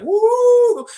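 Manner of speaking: high, loud "woo"; retold whoop. Retelling him grading dance moves, high loud whoop. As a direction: imitate his long, high whoop.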